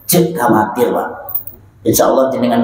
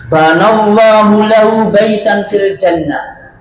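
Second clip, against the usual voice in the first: recitation; 5 semitones higher, 3 dB louder, slower than usual. Real, not acted. Reciting hadith in Arabic.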